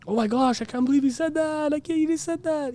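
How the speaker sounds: high-pitched mock amazement; gushing crowd. Acting out the crowd going crazy.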